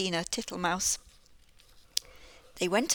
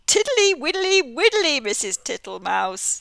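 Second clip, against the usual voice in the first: high, loud smiling toad voice; 6 semitones higher, 8 dB louder than usual. Acted. Mister Jackson the toad grinning at Missus Tittlemouse. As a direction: sing it out with a grin. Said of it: "said the smiling Mister Jackson".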